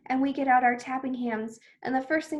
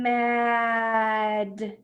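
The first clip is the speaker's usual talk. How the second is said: nice and slow. Shown as a demonstration. Teacher blending sounds for children, Mad nice and slow. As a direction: stretch every sound of Mad. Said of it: "nice and slow".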